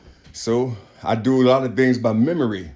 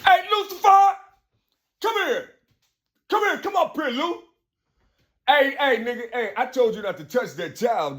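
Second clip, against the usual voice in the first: very high calling, then scolding; mock God scolding; 10 semitones higher than usual. God scolding Lucifer, high and stern. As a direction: call him over and scold him.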